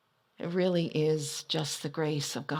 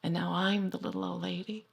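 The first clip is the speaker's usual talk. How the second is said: usual speaking voice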